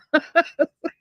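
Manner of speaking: high-pitched giggling laugh; amused laugh. Real, not acted. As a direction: crack up at your own story. Laughing at her own story.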